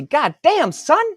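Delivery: very high, loud whoop; wild excitement. Real, not acted. Author cheering his own numbers, very high loud whoop. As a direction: shout it out in triumph. Celebrating his sales total.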